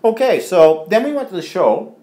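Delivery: talking while chewing; mouth full. Real, not acted. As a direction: say it round a mouthful of food. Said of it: "I'm talking with my mouth full".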